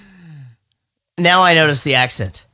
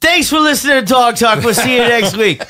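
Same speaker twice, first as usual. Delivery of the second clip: shouted parody podcast sign-off; mock show host; 7 semitones higher, 4 dB louder than usual. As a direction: sign off like an over-keen host.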